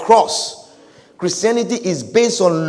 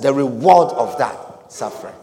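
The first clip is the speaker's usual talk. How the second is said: ordinary talk, nothing marked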